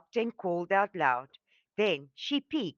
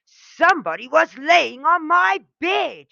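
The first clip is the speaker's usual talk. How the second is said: loud, higher furious bear roar; angrier roar; 11 semitones higher, 10 dB louder than usual. A narrator's reading. Daddy Bear finds his bed used, roaring furiously. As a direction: bellow it, angrier than ever. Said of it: "even more angry and roared".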